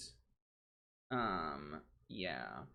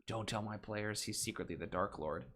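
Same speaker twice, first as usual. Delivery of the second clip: ordinary talk, nothing marked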